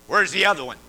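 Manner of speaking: lightly gruff mannish demand; a hint of a stern female officer; masculine voice. Officer to chaplain, a mildly masculine demand. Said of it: "in a very masculine fashion said".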